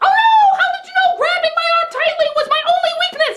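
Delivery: very high, hammed-up alarm; mock damsel panic. [name] is grabbed by the villain, shrieking mock alarm.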